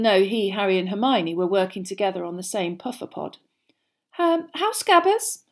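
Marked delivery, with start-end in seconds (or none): 4.2-5.4 slow, high, hesitant question; timid. A narrator's reading. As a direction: ask gingerly, unsure of the welcome. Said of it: "Hermione asked timidly".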